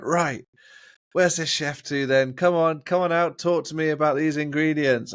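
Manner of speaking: calling the chef out like you own the place; confident calling out. Retold or imitated. New salesman calling into the kitchen, brash and loud. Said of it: "I was like".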